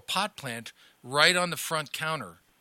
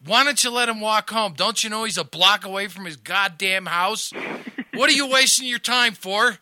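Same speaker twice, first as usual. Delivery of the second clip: loud, higher scolding shout; lieutenant yelling; 7 semitones higher, 9 dB louder than usual. Retold or imitated. Lieutenant yells at the rookie for stopping him, loud and higher. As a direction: chew out the new cop. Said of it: "I hear him screaming at the guy".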